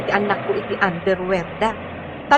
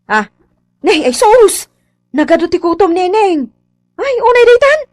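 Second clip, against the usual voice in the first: put-on loud, high fussing at the child; mother fussing loudly over a child's lice; lice scolding; 6 semitones higher, 8 dB louder than usual. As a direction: scold the girl about her lice loudly. Scolding girl full of lice.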